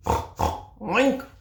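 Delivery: loud, snorty oink; pig oink. Acted. Puppy answers his mother with a loud oink.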